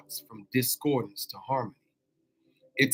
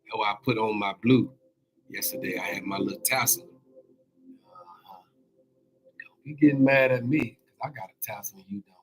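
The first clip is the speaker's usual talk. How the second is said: ordinary delivery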